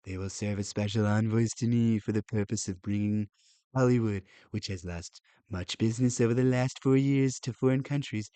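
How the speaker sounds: put-on Donald Trump accent. Reader restarting the president's post to make it easier, Trump accent. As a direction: read it out in a Trump-style accent. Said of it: "when I do it in the accent".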